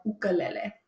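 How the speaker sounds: "ukulele" said oo-kuh-LEH-leh; "ukulele" as in the original language, oo-kuh-leh-leh; correct pronunciation. Shown as a demonstration. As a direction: open "ukulele" on "oo" and close on "leh". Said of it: "we need to pronounce it correctly".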